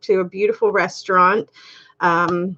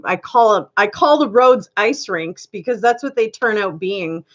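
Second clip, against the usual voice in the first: ordinary talk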